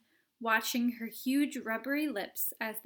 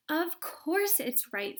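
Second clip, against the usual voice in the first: defiant; 7 semitones higher, 4 dB louder, slower than usual. Acted. Violet defiant.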